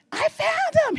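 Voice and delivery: high, loud, thrilled cry of discovery; excited shout. Retold or imitated. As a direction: call it out to her husband as if she's just struck gold. Running through the house after a find.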